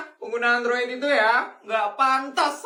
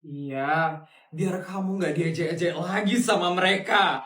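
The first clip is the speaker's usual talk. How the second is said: kind rich aunt; 7 semitones lower than usual. Acted.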